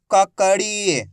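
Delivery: ककड़ी read out with a long ई; drawn-out long ई. Shown as a demonstration. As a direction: stretch the final ड़ी of ककड़ी. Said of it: "खींच के पढ़ा जाता है".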